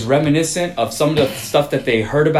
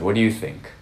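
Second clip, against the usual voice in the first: deadpan; 4 semitones lower, 5 dB quieter than usual. Retold or imitated. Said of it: "he deadpan looked at him".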